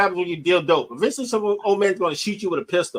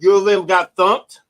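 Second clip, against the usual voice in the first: slow punchline delivery; slower than usual. Acted. Punchline wordplay delivered for effect.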